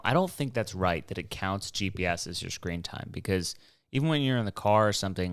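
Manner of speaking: normal talking voice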